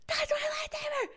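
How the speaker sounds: high, squeezed mock scream, held back in volume; imitated scream. Retelling the lightsaber owner's reaction, strained mock scream. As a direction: mimic a scream without full voice. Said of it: "He's screaming so loud".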